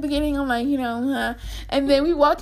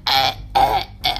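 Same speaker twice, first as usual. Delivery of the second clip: much lower, strained mock choking grunts; choking sounds; 12 semitones lower than usual. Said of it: "I'm like".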